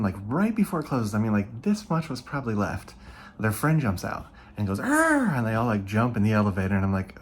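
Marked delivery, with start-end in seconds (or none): 4.8-5.3 sudden high yell of a scare; mock monster roar. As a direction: jump out and roar at them. Kid jumps out to scare.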